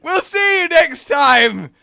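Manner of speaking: high, loud, drawn-out farewell; shouted sign-off. Pretend. Host cutting off the joke for viewers, shouted sign-off. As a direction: yell the outro slowly.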